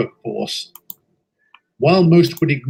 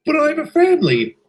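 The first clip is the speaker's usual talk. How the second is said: much higher, louder, pleading cry; desperate plea; 11 semitones higher, 3 dB louder, faster than usual. Acted. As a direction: beg not to be fired. Sacked worker pleads.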